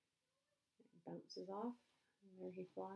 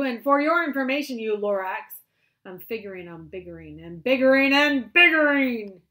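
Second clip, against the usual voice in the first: loud, high furious shout; yelling Once-ler; 5 semitones higher, 19 dB louder than usual. Acted. Once-ler yells at the Lorax, loud and furious. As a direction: roar back defiantly. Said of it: "I yelled at the Lorax".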